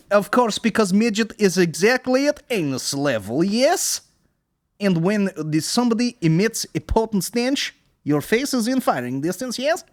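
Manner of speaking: put-on high, loud explaining Russian accent; over-explain like the Russian guy. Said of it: "The Russian guy who tells a joke".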